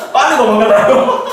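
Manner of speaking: laughing out high and loud. Retold or imitated. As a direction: burst out laughing through the words.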